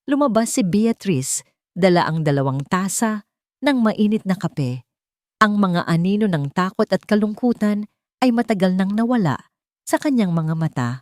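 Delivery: usual speaking voice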